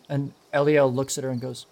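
normal talking voice

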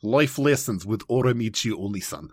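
title read in a Russian accent. Shown as a demonstration. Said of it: "Say the title in, in your Russian accent".

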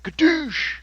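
high martial arts cry; Kickboxer movie yell. Retold or imitated. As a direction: shout it like a fight movie. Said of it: "I could have been like the guy in Kickboxer".

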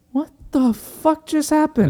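high, hammed-up baffled priest; bewildered priest. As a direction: play the stunned priest left behind. Bewildered priest in retold scene.

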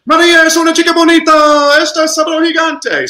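loud, very high excited proclamation; hammed-up high exclamation in Spanish; exclaiming from the start. Shown as a demonstration. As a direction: cry it out at full pitch from the first word. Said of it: "upside down exclamation point".

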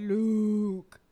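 loud booming villain voice; Darth Vader. Retold or imitated. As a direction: boom it out, menacing. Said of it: "starts talking like Darth Vader".

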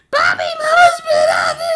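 put-on very high, loud child shout; imitating a shouting kid. Dad voicing the youngest tattling: very high, loud child shout. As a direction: squeal it like the littlest kid. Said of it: "Sinai's like".

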